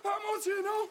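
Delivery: high, overwhelmed repeated cries; frantic crying out. Acted. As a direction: cry out over and over, far above your normal pitch. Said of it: "J'ai entendu crier". (cris affolés et aigus)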